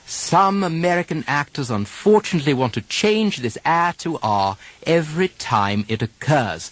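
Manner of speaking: usual speaking voice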